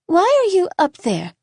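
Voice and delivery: put-on curious little girl. A narrator's reading. Little girl questioning a boy in a tree, curious and high. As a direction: call up like an inquisitive child. Young Layla calls up at boy.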